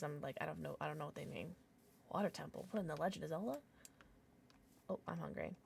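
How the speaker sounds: ordinary talk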